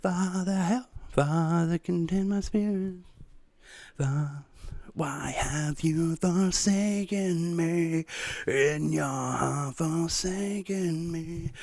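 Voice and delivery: slow, soft, high mock-anguished prayer; hammed-up tragic lament; theatrical whispered plea to Father; melodramatic whisper. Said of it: "I'm trying to whisper it".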